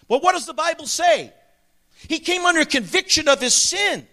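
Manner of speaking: impassioned pulpit appeal; fervent preaching. Real, not acted. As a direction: press the congregation with urgent conviction. Preaches with rising fervour.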